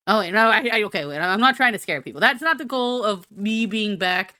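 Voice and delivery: ordinary delivery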